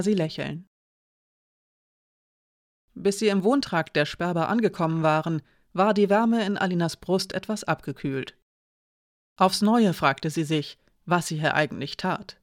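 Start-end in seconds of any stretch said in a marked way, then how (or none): none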